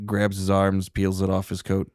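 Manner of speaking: usual speaking voice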